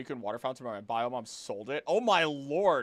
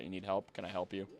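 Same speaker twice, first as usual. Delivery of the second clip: ordinary talk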